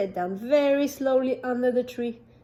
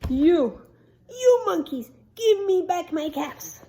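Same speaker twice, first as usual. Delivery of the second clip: high, louder furious yell; angry shout; 6 semitones higher, 6 dB louder than usual. Acted. Peddler shouting at monkeys for his caps, angry.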